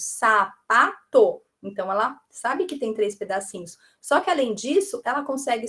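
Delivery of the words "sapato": "Sapato" very slow, in three separate beats; syllable by syllable. Shown as a demonstration. Teacher divides the word into three pieces, syllable by syllable. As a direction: break "Sapato" into sa, pa, to. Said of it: "dividir aquela palavra em três pedacinhos".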